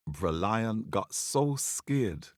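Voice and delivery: ordinary delivery, nothing marked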